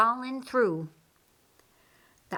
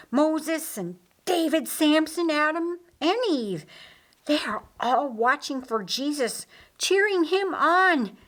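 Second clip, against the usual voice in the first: excited; 7 semitones higher than usual. Acted. Lazarus lists cheering heroes.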